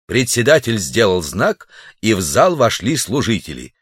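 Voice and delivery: plain reading voice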